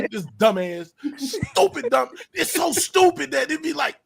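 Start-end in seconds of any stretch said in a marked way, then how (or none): none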